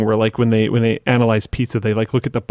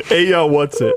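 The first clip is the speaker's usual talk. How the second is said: higher mock American accent; 4 semitones higher than usual. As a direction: repeat it, hammier, laughing. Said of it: "that's how you sound, Americans".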